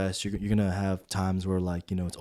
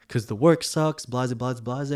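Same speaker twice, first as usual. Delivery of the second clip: higher, louder griping; exasperated complaint; 4 semitones higher, 3 dB louder than usual. Retold or imitated. Stressed supervisor answering his mentor, exasperated. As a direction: reel off a retold gripe, pitched up.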